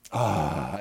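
usual speaking voice

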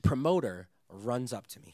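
ordinary delivery

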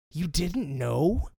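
slow, incredulous retort. Retold or imitated. Staff turning on the clueless barista, slow disbelief. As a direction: drag it out in mock disbelief.

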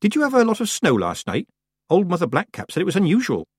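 high, hurried small talk; change the subject fast; nervous girl voice. A narrator's reading.

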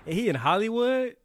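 high, loud disbelieving question; can't believe it, half laughing; incredulous. Real, not acted. Mocking Cena's bald spot.

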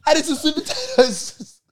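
mocking repeat. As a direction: savour each word mockingly.

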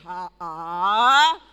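loud, wondering exclamation as God; God amazed. Retold or imitated. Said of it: "God said".

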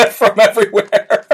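breathless laugh around the words; real helpless laughter; talking through laughter. Real, not acted. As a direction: crack up while finishing the thought. Cracks up at audience comments.

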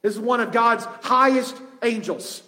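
ordinary talk

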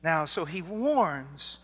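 normal talking voice

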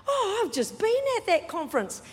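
high-pitched acted-out excitement; excited reply. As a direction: play the delighted coincidence. Acts out Freddie's excited reply.

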